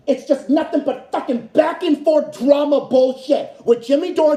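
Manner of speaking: usual speaking voice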